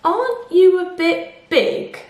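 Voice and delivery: high, slow questioning bee voice; a clever little bee, doubtful; suspicious bee. Acted.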